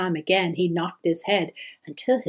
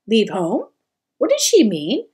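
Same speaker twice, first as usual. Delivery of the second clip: shocked disbelief; 4 dB louder than usual. A narrator's reading. Shocked son questioning.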